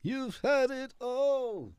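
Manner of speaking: high, drawn-out wail of complaint; theatrical lament. Acted. Speaker protests that nothing is left, high theatrical lament. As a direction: stretch every word high in mock outrage.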